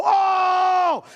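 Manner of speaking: sudden high cry, pupils stung by light; pained cry. Pretend. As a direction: yelp high and loud as if blinded. Flashlight shone in dark eyes.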